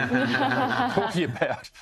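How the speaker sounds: high, laughing, mock-indignant; laughing incredulous retort. Candidate retorting to a socialist stranger, laughing and high. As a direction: toss it back through a laugh, in disbelief.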